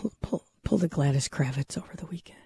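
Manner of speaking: hushed, unvoiced confession; whispering. Real, not acted. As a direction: whisper it like a guilty secret. Confessing a secret, leaning in.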